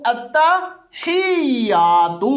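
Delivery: التحیہ slow with a push on the ہ and the ت doubled; slow demo with stress on the ہ; jolt on ha. Shown as a demonstration. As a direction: press on the ہ in التحیہ, slowly. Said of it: "یا کے اوپر شد ہے".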